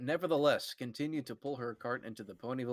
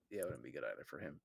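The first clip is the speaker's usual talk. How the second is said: fast mumble; 10 semitones lower, 15 dB quieter, faster than usual. Real, not acted. Rushed aside.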